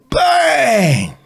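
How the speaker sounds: loud high imitated bang; mock bang. Retelling the homemade cannon going off, loud mock bang.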